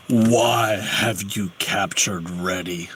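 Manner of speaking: low, slow dragon voice; deep slow rumbling dragon. Acted. Dragon speaks.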